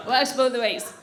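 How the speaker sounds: low-pitched repeat of a punchline; low, understated tag line; low aside. Real, not acted. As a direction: drop the pitch and state it matter-of-factly.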